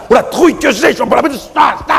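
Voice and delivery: mock panic. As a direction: yelp with stage fright before gulping.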